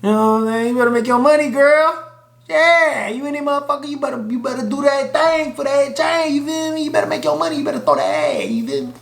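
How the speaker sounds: hammed-up cheering for her to make money; hyped cheering. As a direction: cheer her on like a hype friend. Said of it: "I was like".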